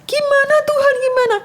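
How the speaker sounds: high, pleading and despairing; a retold tearful plea to God; crying prayer. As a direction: beg God through tears. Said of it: "saya nangis".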